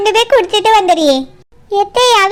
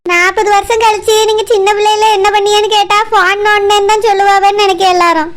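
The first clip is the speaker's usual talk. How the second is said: usual speaking voice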